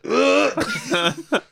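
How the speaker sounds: loud, high gagging noise; imitated retch. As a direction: mimic a sudden dry heave. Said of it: "They're like".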